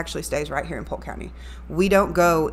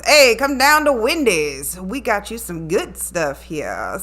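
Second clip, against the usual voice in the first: slow, higher Cajun or very Southern accent; Wendy's Southern voice; 3 semitones higher, 4 dB louder, slower than usual. Shown as a demonstration. Radio host shows her Southern voice for the burger ad. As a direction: drawl it out like a Southern ad, higher and louder. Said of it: "I also do the Wendy's voice".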